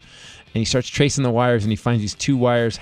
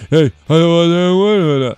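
hammed-up muffled shout from inside a house; drawn-out yell heard through the walls; a wife yelling from indoors, heard far off; slow, loud distant yell; 5 semitones higher, 7 dB louder, much slower than usual. Retold offstage argument heard from house.

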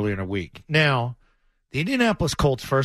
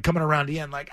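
ordinary delivery

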